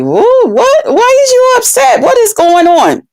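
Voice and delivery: high-pitched, baffled questions; retold bewilderment. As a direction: replay your confusion with pitch jumping up. Retells her bewildered reply to him.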